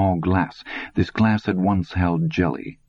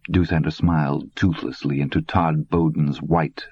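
ordinary delivery, nothing marked

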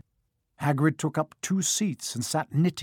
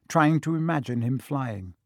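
usual speaking voice

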